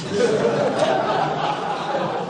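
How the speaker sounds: high whispered laugh; breathy high cackle. Retold or imitated. The mother laughing at her son, high and airy.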